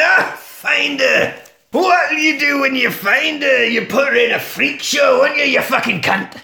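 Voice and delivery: high, loud mock tirade; acted outrage. Jack turns on the American, mock furious shout. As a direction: shout it in hammed-up indignation.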